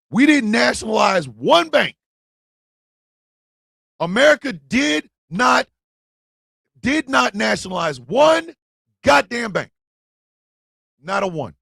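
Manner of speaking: shouting at the banks' bailout; angry shout. Real, not acted. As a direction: yell it out in outrage. Angry rant about bank bailouts.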